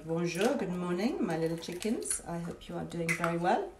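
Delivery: a gentle hint of French, greeting hens; French accent. Greeting her French hens in a soft mock French accent. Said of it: "I always speak to my girls in a French accent".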